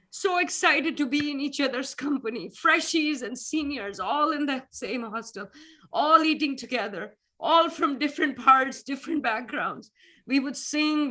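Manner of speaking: reliving happy hostel days, voice lifting; emotional. Real, not acted. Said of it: "Sorry, I get emotional".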